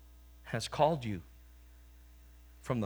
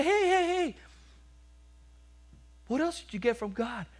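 higher, excited call across the street; 12 semitones higher than usual. Acted. People in heaven calling to the young guy on his bike, eager call. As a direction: hail him from the corner, curious.

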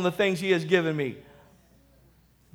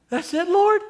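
high-pitched mock disbelief; incredulous dismay; 11 semitones higher than usual. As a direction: squeak out comic disappointment to the Lord. Disappointed man questions his heavenly reward.

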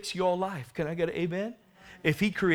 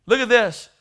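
ordinary talk, nothing marked

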